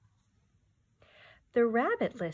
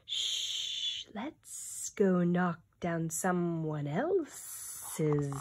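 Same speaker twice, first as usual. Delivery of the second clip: hissing, slow sly snake voice; much slower than usual. Acted. Teacher voicing the snake urging revenge, slow and hissing. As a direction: hiss it out slyly.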